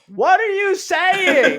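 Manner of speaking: high, slow, laughing shout; shouting over music. Retold or imitated. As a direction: yell it over loud club music. Guy shouting over loud music.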